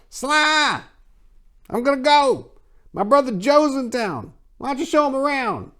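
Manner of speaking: high, carrying sister's call; calling into the house. A narrator's reading. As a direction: call out to Sly inside the house.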